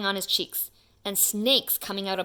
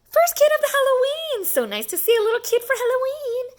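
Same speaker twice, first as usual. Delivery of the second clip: much higher, louder gushing voice; lady greeting; 6 dB louder, faster than usual. Acted. Lady at the door gushing over the first kid. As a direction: gush sweetly and fast. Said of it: "A lady opened up the door and said".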